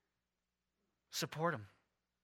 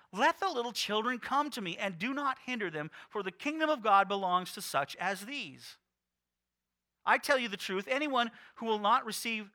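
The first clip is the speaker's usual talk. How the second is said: raised, earnest Bible reading; scripture reading; 4 semitones higher, 4 dB louder than usual. Acted. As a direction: read the passage with lift and weight. Reads scripture aloud.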